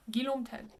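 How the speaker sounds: "Guillaume Tell" in French, ghee-LOHM; closed O. Shown as a demonstration. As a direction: model "Guillaume Tell" without any W sound. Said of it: "Closed O".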